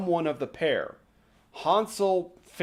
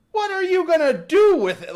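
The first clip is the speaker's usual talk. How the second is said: louder, higher disbelief; incredulous; 8 semitones higher, 7 dB louder than usual. Real, not acted. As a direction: throw it at the crowd, baffled. Incredulous rant at the audience.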